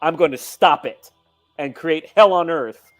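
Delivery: ordinary delivery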